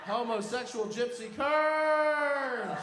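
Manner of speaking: high drawn-out shout; mock curse cry. Comedian hurling a curse, drawn-out shout. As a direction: wail it out, stretching the last word.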